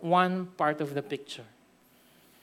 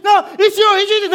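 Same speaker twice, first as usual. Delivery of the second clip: shouted high and loud, hammed-up quarrel; mock shouting; 11 semitones higher, 11 dB louder than usual. Neighbor shouting in a mock quarrel, loud and high. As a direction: yell it like a neighbor picking a fight. Acted quarrel over shawarma.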